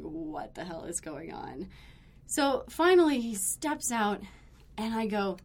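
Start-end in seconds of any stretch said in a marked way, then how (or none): 0.0-1.7 soft, slow, lowered voice; nervous undertone. Retold or imitated. Nervous players wondering among themselves, hushed aside. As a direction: imitate bystanders nervously murmuring. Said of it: "super nervous and just going".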